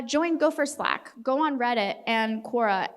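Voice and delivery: ordinary talk, nothing marked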